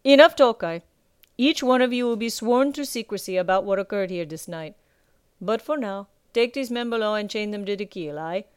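gruff pirate captain. Acted. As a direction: lord it over the captured pirates. Captain ends the talk.

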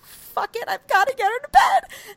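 very high, strained retold outburst; desperate resolve. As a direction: build to a loud high cry. Acting out desperate resolve while driving.

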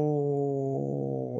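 "pal" with a long L receding into softness; "pal" gurgling away on a softening, sustained L; held L fading away. Shown as a demonstration. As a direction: stretch the L of "pal" and let it drift off. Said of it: "and it goes away from you".

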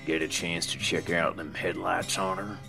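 leering aside. Acted. Earl nudging Dale about the girl, sly and soft.

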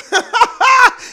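high, loud burst of laughter; real high-pitched cackling laugh. Real, not acted. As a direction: crack up hard at your own joke. Cracks up at own impression.

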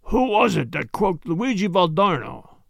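rough-voiced Italian gangster; hoarse threat. Italian with a revolver questioning his captives, hoarse threat. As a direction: demand it hoarsely.

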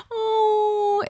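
very high crying oh; a high, wailing sympathetic oh. Retold or imitated. Jonathan mirroring a guest's distress, high wailing oh. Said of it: "he goes".

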